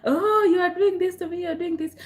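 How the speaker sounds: high mock victim whine; exaggerated self-pitying complaint. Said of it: "you jump to the victim mentality".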